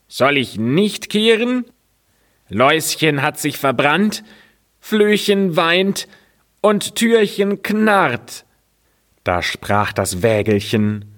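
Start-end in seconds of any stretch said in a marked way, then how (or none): 0.1-8.4 high broom voice, slowing through the list; high, sing-song broom lament. Acted. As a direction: sweep through the woes plaintively. Broom lists the woes.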